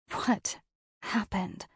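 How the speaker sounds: ordinary delivery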